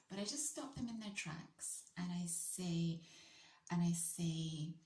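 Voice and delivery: ordinary delivery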